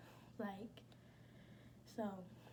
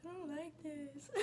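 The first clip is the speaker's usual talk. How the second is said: whiny refusal; 5 semitones higher, 5 dB quieter than usual. Retold or imitated. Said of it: "I'm like".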